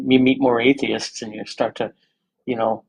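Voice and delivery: ordinary talk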